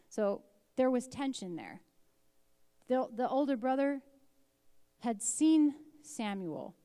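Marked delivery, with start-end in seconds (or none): none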